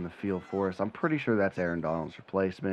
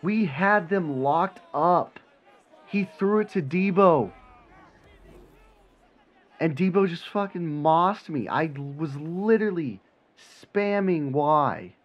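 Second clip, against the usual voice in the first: frustrated; 7 semitones higher, 3 dB louder, slower than usual. Real, not acted. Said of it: "I'm so frustrated".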